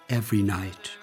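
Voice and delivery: ordinary delivery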